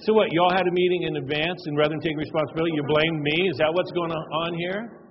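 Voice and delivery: a dad losing his cool in a family meeting, shouting; indignant outburst. Retold or imitated. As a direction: fire back at the kids, offended. Dad angrily confronts his kids.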